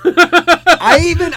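laughing high and slow through the words; breaking up with laughter mid-sentence; giggling as he starts his story. Real, not acted. Laughing while telling.

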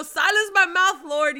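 loud, high desperate plea; pleading cry. Retold or imitated. She's voicing her own prayer to God, loud pleading cry.

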